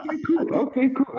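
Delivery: words through a high laugh. Real, not acted. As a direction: chuckle as you agree. Laughing along with Keenan.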